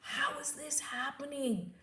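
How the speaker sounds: slow, whispered amazement; hushed wonder. Retold or imitated. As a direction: whisper it in disbelief. Voicing her awed inner question.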